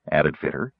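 ordinary talk, nothing marked

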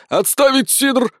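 high, drawn-out military command; exclaiming. A narrator's reading. Sergeant overrules the cider order, exclaiming. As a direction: cancel the order with a slow, high cry. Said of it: "воскликнул Сисюля".

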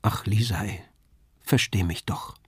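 soft, low plea; pleading. A narrator's reading. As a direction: beg quietly, almost whispering. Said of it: "und ich bat nur".

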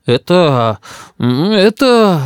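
flustered, drawn-out stammer; muttering. A narrator's reading. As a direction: fumble for an answer as cornered grandpa. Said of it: "забормотал дедушка".

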